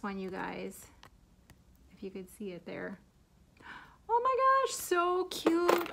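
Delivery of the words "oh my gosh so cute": high loud squeal of delight. Real, not acted. Thrilled unboxing a gift, she squeals at her viewers. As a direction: squeal it much higher and louder.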